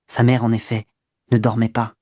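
ordinary delivery

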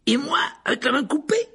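high-pitched, eager comeback; excited retort. A narrator's reading. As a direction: top the friend's boast. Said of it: "répliqua Dean". (réplique vive et aiguë)

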